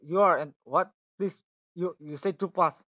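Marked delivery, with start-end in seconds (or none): none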